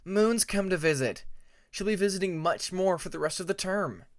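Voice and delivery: put-on a young dragon excitedly telling his friend. A narrator's reading. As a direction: share the good news eagerly.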